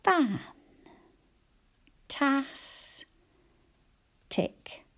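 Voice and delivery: Fan, Tas, Tick said apart with clear gaps; syllable by syllable. Shown as a demonstration. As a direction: split fantastic into three clear beats. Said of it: "we can hear them all".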